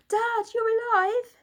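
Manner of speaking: very high, loud joyful cry; boy amazed his dad lives; amazement. A narrator's reading. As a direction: burst out in amazed relief. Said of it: "said William in amazement".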